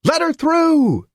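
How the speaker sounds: man in the crowd yelling at police; hoarse yell. A narrator's reading. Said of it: "A hoarse voice in the crowd yelled".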